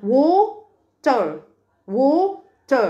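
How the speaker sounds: "water" as long wo, pause, ter; split into syllables. Shown as a demonstration. Teacher divides water for pupils, syllable by syllable. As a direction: stretch "water" apart in two beats. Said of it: "قسمناها ل two syllables".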